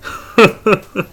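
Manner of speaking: brief scoffing chuckle that falls away; short laugh. Acted. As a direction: laugh it off with amusement. 客が店員の言葉に笑う. (鼻で笑うような短い笑い)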